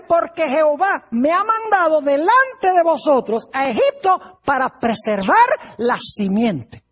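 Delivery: slow, loud scriptural proclamation; emphatic declamation. Retold or imitated. Joseph explains God's purpose to his brothers, emphatic declamation. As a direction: proclaim it slowly, leaning hard on key words.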